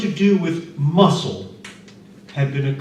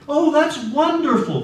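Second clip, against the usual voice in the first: put-on delighted old man; 13 semitones higher, 4 dB louder than usual. A narrator's reading.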